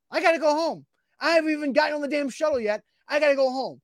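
high-pitched mock distress; retold exasperated panic. Soaked man fretting over soap in the water, exasperated. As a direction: replay a worked-up fret. Retelling his worry about soapy water.

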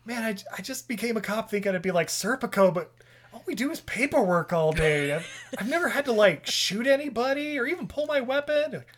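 imitating characters. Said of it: "He's like".

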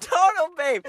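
high excited shout. Acted. Echoing friend's hype.

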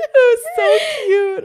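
high, loud, drawn-out squeal; gushing. Real, not acted. Co-hosts adoring a fictional fire sprite, gushing squeal. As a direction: squeal with laughing adoration. Gushing over a favourite character.

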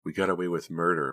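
usual speaking voice

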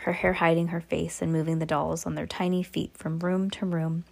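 ordinary delivery, nothing marked

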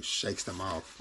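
usual speaking voice

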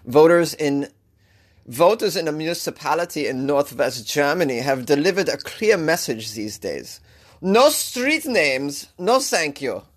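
news read in a hammed-up German accent; bad German accent. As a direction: amateurish German-accented newsreader. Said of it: "This is the worst German accent".

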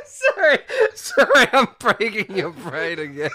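high, loud laughing apology; hiccup giggles. Real, not acted. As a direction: apologise while giggling helplessly. Laughing fit while apologising.

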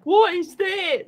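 Gordon Ramsay. Retold or imitated. Chef pulling stuff from the fridge, mock exasperation. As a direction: imitate an appalled chef, stretching each word. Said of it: "Gordon Ramsay goes into the kitchen".

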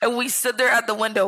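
usual speaking voice

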